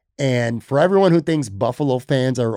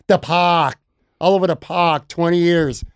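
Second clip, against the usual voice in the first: mock Boston fan accent, dropped R in park; playful Boston-accent echo; imitated Boston accent; 4 semitones higher, slower than usual. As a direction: parrot the Boston caller, a bit higher. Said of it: "The accent, the, uh, the overreaction".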